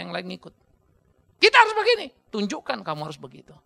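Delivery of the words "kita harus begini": high loud mock decree; mock bossy father. Preacher mocking a father's loud order. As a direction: mimic a commanding father. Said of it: "Jangan bilang".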